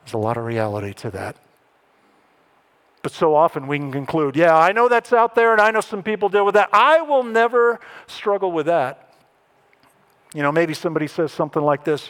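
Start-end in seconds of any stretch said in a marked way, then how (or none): 4.4-9.1 high, loud mock self-assurance; acted-out cocky denial; overconfident dismissal. Voices our overconfident dismissal.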